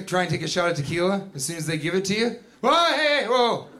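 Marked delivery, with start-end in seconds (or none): 2.6-3.7 higher-pitched "hold on"; urgent interjection. Acted. Bartender halting someone about to drink tequila, urgent. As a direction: cut in to stop a drinker.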